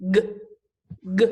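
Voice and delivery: name the letter G, then give only its sound g. Shown as a demonstration. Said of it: "What sound does my G make?".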